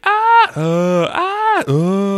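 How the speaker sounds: high, loud alternating yelps; back-and-forth mock screams. Minions and startled student scream at each other, alternating yelps. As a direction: scream back and forth as two panicked parties. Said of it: "they're screaming at each other".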